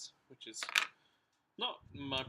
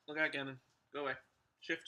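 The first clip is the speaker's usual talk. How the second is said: loud, higher calls to shift the dog; raised voice telling Gannon to get out from under her feet; shooing dog; 4 semitones higher, 9 dB louder than usual. Real, not acted. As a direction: shoo Gannon from underfoot, firmly.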